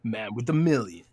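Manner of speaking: nasal game announcer voice; booming arena announcer; Halo announcer voice. Shown as a demonstration. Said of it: "talk like the Halo announcer".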